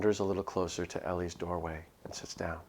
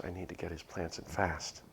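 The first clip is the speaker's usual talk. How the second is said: ordinary delivery, nothing marked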